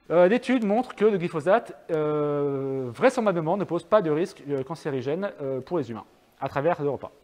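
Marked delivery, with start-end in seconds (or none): none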